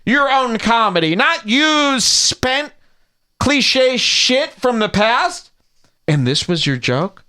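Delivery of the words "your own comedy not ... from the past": high, slow, declaiming lecture; hammed-up high-pitched scolding; mock-indignant oration. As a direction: stretch each word in lofty outrage.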